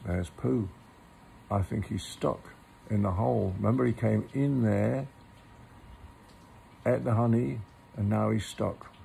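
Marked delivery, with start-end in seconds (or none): none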